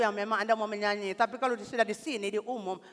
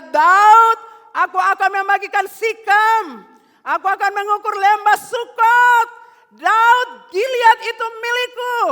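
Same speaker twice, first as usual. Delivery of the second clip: much higher, louder, slow shouted proclamation; God's booming voice; 18 dB louder, slower than usual. Acted. God answering David, shouting a slow proclamation. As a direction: thunder out the words as God. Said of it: "Tuhan berkata".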